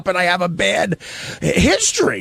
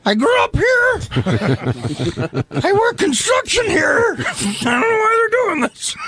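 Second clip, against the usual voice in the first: a much higher, shouted working-man voice; mock tough local guy; 10 semitones higher than usual. [name] clowns when handed the mic, high mock shout. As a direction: ham up a construction guy defending his hometown.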